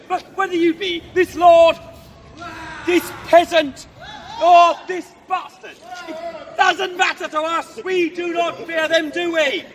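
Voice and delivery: loud, drawn-out challenge; shouted stage defiance; defiant battle oratory. Acted. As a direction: dare the enemy armies to attack. Guard taunts the attackers.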